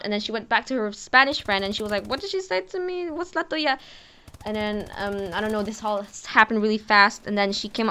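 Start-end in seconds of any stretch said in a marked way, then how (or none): 2.1-3.8 higher, puzzled questioning voice; mock confused voice; confused girl. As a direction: play the bully, baffled by the words. Confused girl asks her friend.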